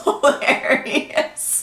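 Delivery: word broken up by a high laugh. Real, not acted. Patient amused at bumping trash can, laughing. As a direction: laugh through a drawn-out word. Laughing while recalling the bumps.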